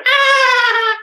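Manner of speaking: high, loud yelp of alarm; Otaku's startled cry. Acted. Otaku yelps at the scene, a high startled cry. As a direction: let out a sudden squeal of fright. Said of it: "we cut to Otaku going".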